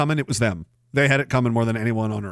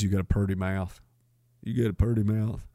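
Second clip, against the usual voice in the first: hillbilly drawl; 5 semitones lower, 6 dB quieter than usual. Retold or imitated. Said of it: "Walk in Style says".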